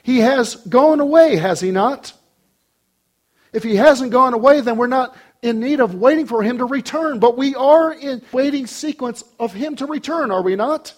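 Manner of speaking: insistent preaching. Real, not acted. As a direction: press the point home to the congregation. Preaches at full force.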